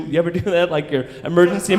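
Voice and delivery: ordinary delivery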